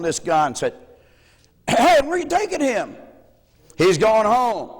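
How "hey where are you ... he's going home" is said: higher call across the hall. Retold or imitated. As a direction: call out to the orderly. Stranger calls out in hospital.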